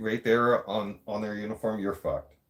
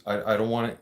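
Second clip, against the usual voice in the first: normal talking voice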